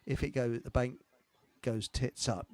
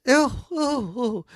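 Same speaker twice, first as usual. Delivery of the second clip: shocked gasp; 11 semitones higher, 6 dB louder, slower than usual. Retold or imitated. Shocked hosts in joke.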